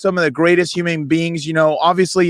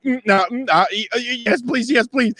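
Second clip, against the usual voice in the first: higher, stammering starstruck plea; tongue-tied; 6 semitones higher than usual. Retold or imitated. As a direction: stumble through hums, then blurt the plea. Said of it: "of course, I'm tongue-tied".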